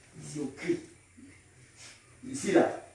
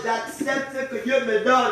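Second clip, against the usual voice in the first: higher, more forceful declaration; raised, emphatic preacher's line; saying it strongly; 8 semitones higher, 6 dB louder than usual. Shown as a demonstration. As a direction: model a strong confession for the congregation. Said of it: "Dis ça fort".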